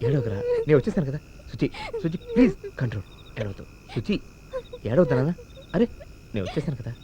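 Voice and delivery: put-on calm a crying child; hushed reassurance to Suji; soothing. Consoling a crying girl.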